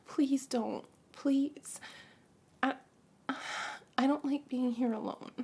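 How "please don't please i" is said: ordinary delivery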